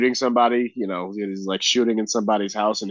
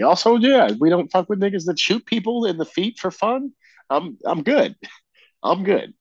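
loud; 8 semitones higher, 4 dB louder than usual. Real, not acted. Loud dismissive aside.

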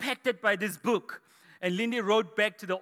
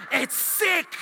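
high-pitched burst of hype; hyped-up exclamation; 9 semitones higher than usual. Real, not acted. Hyping slang word for young adults.

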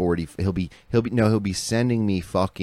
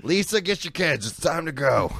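higher barked command; gruff grandpa order; 10 semitones higher than usual. Retold or imitated. Grandfather ending the visit, mock gruff order. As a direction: order the family out. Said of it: "be like".

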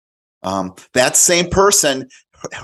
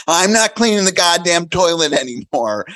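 put-on higher, louder kid voice; child's voice; 7 semitones higher, 3 dB louder than usual. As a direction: parrot it like a cheeky kid. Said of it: "And he said".

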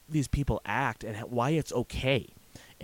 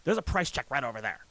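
annoyed sigh; 3 semitones higher than usual. Retold or imitated. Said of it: "She sighs at me and goes".

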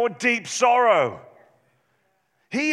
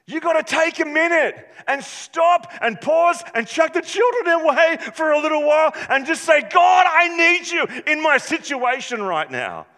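loud, high, driving sermon voice; full-force preacher calling the church to act; fired-up preaching; 8 semitones higher, 5 dB louder than usual. Acted. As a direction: preach it hard to the congregation.

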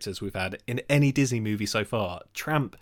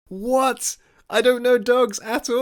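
loud, high-pitched outburst of disbelief; exasperated protest; 13 semitones higher, 7 dB louder, slower than usual. Real, not acted. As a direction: protest loudly, voice shooting way up. Corrected on dog breeds, protests.

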